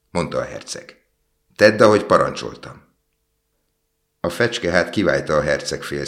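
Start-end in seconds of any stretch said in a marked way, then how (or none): 1.6-2.9 raised, forceful order; insistent princely order; firm command. A narrator's reading. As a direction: command it loudly, pitched up on the first word. Prince commands the reluctant swallow.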